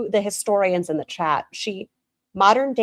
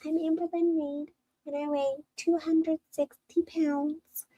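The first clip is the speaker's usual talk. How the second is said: high, airy mock-introduction voice, slowed down; imitating Amberlynn Reed; 7 semitones higher than usual. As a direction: parody her sweet vlog greeting. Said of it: "she's like".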